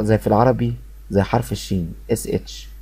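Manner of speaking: normal talking voice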